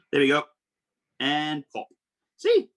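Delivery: sudden very high squeal of See; delighted squeak at the reveal; excited. Real, not acted. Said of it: "showing eyes popping back".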